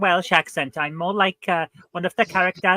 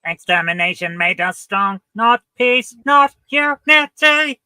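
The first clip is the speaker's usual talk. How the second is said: rising, louder robotic screech; Dalek voice; 6 semitones higher, 6 dB louder than usual. Acted. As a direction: grate it out like a killer robot. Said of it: "I'm doing a Dalek accent".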